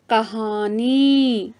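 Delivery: कहानी stretched out very slowly, syllable by syllable; slow demo word. Shown as a demonstration. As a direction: spell out कहानी slowly for the class. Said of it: "तीसरा शब्द है". (बहुत धीमे, शब्दांश-दर-शब्दांश)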